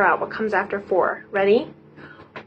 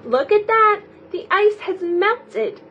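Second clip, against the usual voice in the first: amazed; 9 semitones higher than usual. Acted. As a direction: gasp in delight. Numbers amazed at melting.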